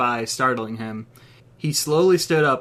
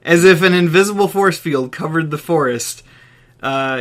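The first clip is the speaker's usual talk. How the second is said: ordinary delivery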